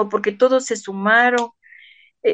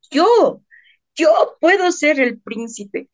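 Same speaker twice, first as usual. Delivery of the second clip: put-on higher, slow, hopeful little boy offering himself; lightly voiced eager boy, drawn out slowly; eager child's voice; 7 semitones higher, 3 dB louder, slower than usual. Boy overhears they lack a prince, eagerly volunteers.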